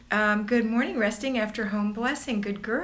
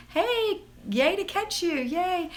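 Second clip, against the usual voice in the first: high, giddy yay; excited; 9 semitones higher, slower than usual. Real, not acted. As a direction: cheer at catching her live. Greeting a favourite viewer.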